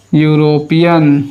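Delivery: drawn-out article and word; slow demo. Shown as a demonstration. Teacher slowly modelling the article before the word. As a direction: say it slowly for the class. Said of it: "यहाँ पे क्या करेंगे?".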